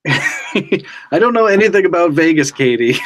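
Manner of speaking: laughing, high and fast. Real, not acted. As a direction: break up laughing mid-line. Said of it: "Those are just two cross streets".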